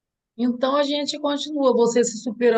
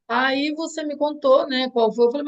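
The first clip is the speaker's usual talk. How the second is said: ordinary delivery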